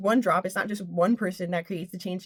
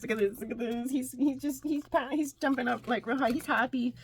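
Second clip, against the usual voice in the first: hushed; 7 dB quieter than usual. Real, not acted. Much softer commentary.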